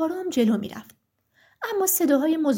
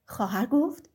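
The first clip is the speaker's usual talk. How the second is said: plain reading voice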